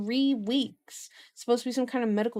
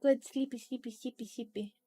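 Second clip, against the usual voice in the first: cutesy goodnight; 2 semitones higher, 4 dB quieter than usual. Real, not acted. Streamer sending a viewer to bed, cutesy and soft. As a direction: coo a goodnight, trailing off very softly.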